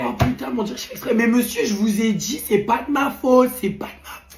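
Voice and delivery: exasperated protest, voice giving out; cracked, broken voice. Acted. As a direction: insist loudly until the voice breaks up. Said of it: "Tu reprends ta voix normale". (voix cassée qui déraille)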